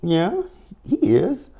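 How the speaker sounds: high teasing yeah he is; sassy agreement. Retold or imitated. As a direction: agree with mock glee. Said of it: "I was like".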